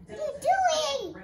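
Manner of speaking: put-on loud childish voice for the dog; playful dog voice. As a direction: speak for the dog in a tug game. Voicing dog in tug game.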